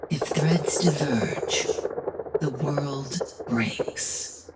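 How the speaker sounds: slow, ominous whispered warning. Acted. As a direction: whisper it slowly as a threat. Creator warning her creation.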